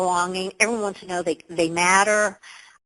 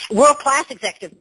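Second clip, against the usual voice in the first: usual speaking voice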